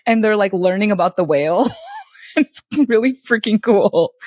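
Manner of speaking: ordinary delivery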